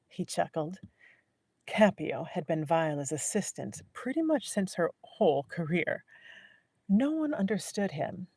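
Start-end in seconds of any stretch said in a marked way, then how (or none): none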